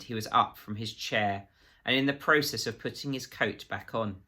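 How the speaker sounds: usual speaking voice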